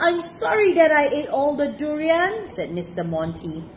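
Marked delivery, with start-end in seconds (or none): 0.0-2.5 high, earnest apology; sorry character voice, pitched up. A narrator's reading. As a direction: plead forgiveness from the children. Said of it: "he really was very sorry".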